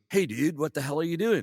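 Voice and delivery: high loud exasperated boss; annoyed boss. Retold or imitated. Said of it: "And he goes".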